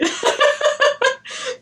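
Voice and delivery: shrill burst of laughter; high laugh. Real, not acted. Laughs at Charlie.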